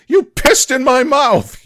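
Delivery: slow, high and loud outraged yell. Acted. Strom yells at Will, disgusted and outraged. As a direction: bellow in disgust. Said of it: "yells Strom".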